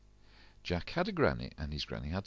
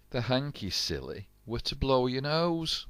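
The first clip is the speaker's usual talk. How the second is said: teasing punchline; 7 semitones higher, 4 dB louder than usual. A narrator's reading. Punchline to the children.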